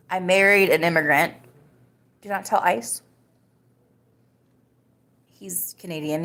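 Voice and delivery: ordinary delivery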